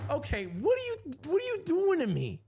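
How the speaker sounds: high-pitched disbelieving whine; pleading, fed-up rising voice; exasperated. Retold or imitated. Exasperated at the ticket agent.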